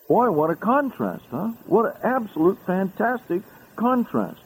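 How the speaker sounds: high, amazed exclamation; marveling. Real, not acted. Preacher marveling to his listeners at the contrast, high and amazed. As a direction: wonder at it aloud.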